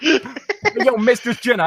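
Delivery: put-on New York Italian, Brooklyn accent. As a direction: greet her like a Brooklyn guy. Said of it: "like, Brooklyn".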